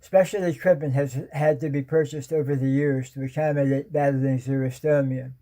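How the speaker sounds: usual speaking voice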